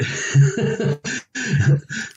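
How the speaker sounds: a high, amused real laugh. Real, not acted. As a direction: laugh at how it all fell apart. Laughs at losing the gimmick.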